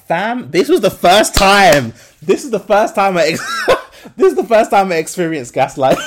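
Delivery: loud laughing disbelief, words breaking into laughter; laughing loudly. Real, not acted. Telling friends about the nan's denial, laughing loudly. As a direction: laugh through it, half shouting.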